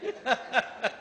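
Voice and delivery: short, high chuckle; real chuckle. Real, not acted. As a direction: laugh briefly at the joke. Joking aside about cut fee. (छोटी, ऊँची हँसी)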